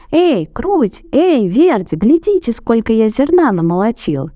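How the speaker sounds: high calling shout; rooster shouting. A narrator's reading. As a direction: call out proudly across the yard. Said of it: "и крикнул".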